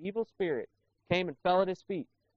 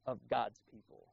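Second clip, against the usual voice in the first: much softer, acting out a shocked objection; hushed indignant voice; 4 semitones lower, 13 dB quieter than usual. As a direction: imitate the indignant crowd, voice sharply dropped.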